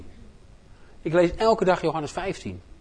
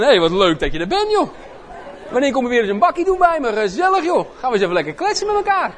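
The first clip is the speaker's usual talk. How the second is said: loud, chummy invitation for coffee; friendly chat; 9 semitones higher, 4 dB louder than usual. Shown as a demonstration. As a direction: sound like a cosy neighbour. Acted casual friendly invitation.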